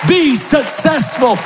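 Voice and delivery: high, shouting preacher's climax; rising shouted sermon climax; preaching at full force. Acted. Preaching climax over music.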